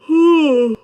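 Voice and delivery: long heavy loud exhale; big loud sigh. Real, not acted.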